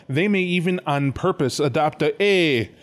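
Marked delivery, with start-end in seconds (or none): 2.2-2.7 cheesy fake New Jersey Italian; shitty New Jersey accent. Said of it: "shitty New Jersey accent".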